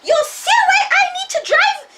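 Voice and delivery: very high, loud mock yell through laughter; grandma yelling at grandpa's driving, high, loud and laughing; laughing yell at husband. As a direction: shriek at the driver while laughing. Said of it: "my grandma yelled at him".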